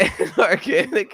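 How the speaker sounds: put-on high, bouncy little beach kid; kid surfer voice. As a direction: read it like an excited kid at the beach. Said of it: "I'm gonna do, like, a kid surf-, surfer".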